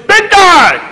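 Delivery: high, loud shout; loud call. Retold or imitated. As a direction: call out to the big guy. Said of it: "She looks down, she goes".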